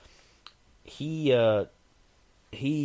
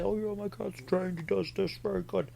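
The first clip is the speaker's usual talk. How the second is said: proud cat owner; 11 semitones higher than usual. Retold or imitated. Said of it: "Some people say".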